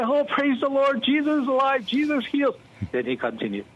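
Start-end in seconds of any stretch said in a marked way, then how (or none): 0.0-2.6 much higher, bursting with joy; excited praise. Retold or imitated. Pastor reacting on air to the caller's healing, excited and much higher. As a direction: exclaim it like you can't contain the thrill. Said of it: "I got excited".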